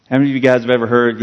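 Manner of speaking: ordinary talk, nothing marked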